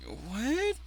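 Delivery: usual speaking voice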